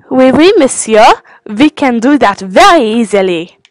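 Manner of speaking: mock French reply; French accent. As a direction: answer obligingly in a put-on French accent. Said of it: "My French impression".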